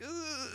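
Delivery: high, soft drawn-out ugh; disgusted groan. Acted. Reacting to feminized fan art, disgusted groan. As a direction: let out a squeamish high groan. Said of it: "I'm, like, a little bit like".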